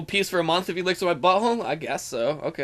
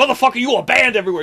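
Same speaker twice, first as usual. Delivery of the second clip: louder, higher, fast mock rant; mock rage; 7 semitones higher, 7 dB louder, faster than usual. Streamer mock-banning a fan, fast and loud. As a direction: fake fury. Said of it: "that's my anger issue problem".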